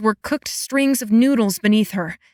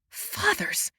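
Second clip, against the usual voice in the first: whispered on a breath; horrified to learn how long she was gone, breathed; 11 semitones higher, 9 dB quieter than usual. A narrator's reading. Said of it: "she breathed".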